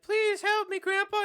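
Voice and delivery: put-on very high, loud child-like plea; Charlie. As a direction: cry out for help in a put-on high boy voice. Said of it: "Charlie's like".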